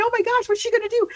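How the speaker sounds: high, rushed suspenseful gasp of a question; mock alarm. Reader in suspense as the husband gets home, mock alarm. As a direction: hurry it out high, playing the anxious reader.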